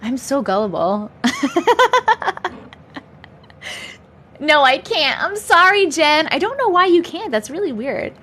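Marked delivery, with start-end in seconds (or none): 1.2-2.5 high burst of real laughter, falling. Real, not acted. Laughing at being scammed by a viewer, high giggle. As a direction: crack up at being fooled.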